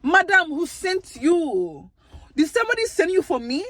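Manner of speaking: put-on playful African accent. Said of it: "And I put in my accent".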